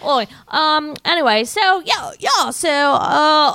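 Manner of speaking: high, loud Aussie-Cockney muddle; lost Australian accent. Shown as a demonstration. Host has caught the Cockney bug, high botched Australian accent. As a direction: flail around hunting for an Australian accent. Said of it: "Where'd my Australian accent go?".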